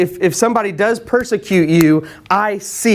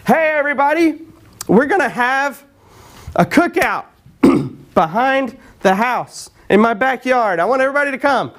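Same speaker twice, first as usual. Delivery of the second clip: calling out, higher pitched, not really shouting; mock rooftop proclamation; 6 semitones higher than usual. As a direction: announce it to the neighbours from a roof. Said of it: "proclaim things from the rooftops like that".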